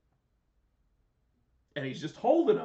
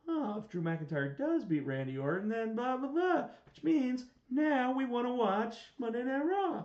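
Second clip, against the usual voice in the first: mock fan reaction; 9 semitones higher than usual. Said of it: "make you go".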